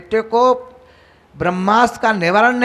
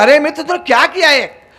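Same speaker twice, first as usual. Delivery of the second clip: higher, louder cry of shock; 7 semitones higher, 6 dB louder than usual. Acted. Duryodhana shown what his friend has done, shocked. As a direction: blurt it out in shocked reproach. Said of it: "Duryodhan देखो चौंक गया".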